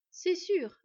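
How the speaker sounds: firm, pressing agreement; emphatic agreement. A narrator's reading. She-wolf backing Beyla up, emphatic. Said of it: "appuya la louve".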